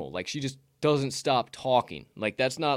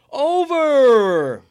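loud, high, drawn-out announcer shout; announcing the result; 12 semitones higher, 12 dB louder than usual. Real, not acted. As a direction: belt it out like a big reveal. Announcing the dice result loudly.

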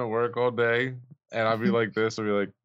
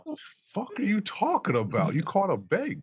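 mock baffled caller; 6 semitones higher than usual. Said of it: "mock caller confusion".

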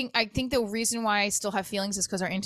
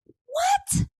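very high, loud yelp of disbelief; stunned, high-pitched "what?"; incredulous; 5 dB louder than usual. Retold or imitated. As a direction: squeal it in sheer disbelief. Incredulous reaction to his praise.

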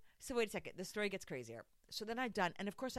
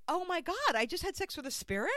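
higher and louder incredulous exclamation; hammed-up amazed realisation; mock astonishment; 9 semitones higher, 6 dB louder than usual. Telling friend the first reaction afterwards, mock astonished.

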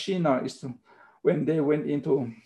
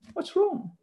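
feigned puzzlement. Acted. Rhetorical question to class.

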